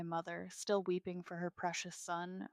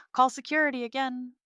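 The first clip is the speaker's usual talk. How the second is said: raised, louder command; exasperated order; 7 semitones higher, 9 dB louder, faster than usual. A narrator's reading. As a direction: order it with fed-up urgency. Exasperated order over phone.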